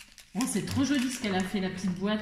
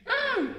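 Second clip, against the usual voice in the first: very high, louder hum of pleasure; high appreciative mmh; 4 dB louder than usual. Real, not acted. Smelling a candle on camera, high pleased hum. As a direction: hum with delight at the scent.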